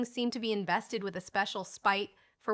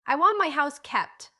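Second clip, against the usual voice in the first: higher, louder insistent demand; 6 semitones higher, 8 dB louder than usual. A narrator's reading. Old gentleman demands his house be kept, loudly. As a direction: insist on it, loud and high. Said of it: "in a loud key".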